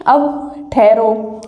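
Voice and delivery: ordinary talk, nothing marked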